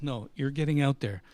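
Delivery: loud, flat-out command; manager insisting. Retold or imitated. Manager overruling the narrator, loud and firm. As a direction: leave no room for argument.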